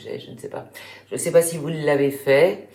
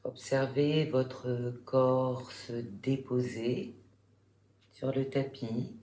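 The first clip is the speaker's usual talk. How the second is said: guided relaxation; 3 semitones lower, 8 dB quieter than usual. Real, not acted. Yoga teacher guiding listeners lying in Shavasana, calm meditation voice. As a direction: guide them calmly through the body, softer and lower, unhurried.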